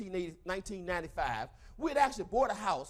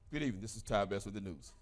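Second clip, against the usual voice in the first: newscaster voice; 13 semitones lower, 6 dB quieter than usual. Retold or imitated. Mock newscaster while laughing.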